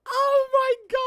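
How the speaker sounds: very high, loud, drawn-out squeal; overwhelmed fangirl squeal. Real, not acted. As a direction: squeal slowly with overwhelmed delight. Overwhelmed by favourite anime opening.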